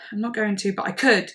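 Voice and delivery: ordinary talk, nothing marked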